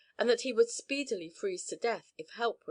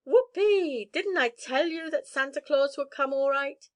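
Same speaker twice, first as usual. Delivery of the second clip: high, loud whoop of joy, then still loud; a boy shouting with delight over his Christmas gifts; 5 semitones higher, 5 dB louder than usual. Acted. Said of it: "shouted the delighted Jimmy".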